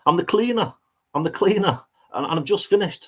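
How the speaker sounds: high, hurried, caught-out protest; flustered cleaner. Retold or imitated. Cleaner jumping off the settee, a high flustered excuse. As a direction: blurt it like you've been caught napping. He goes.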